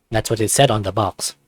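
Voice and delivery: plain reading voice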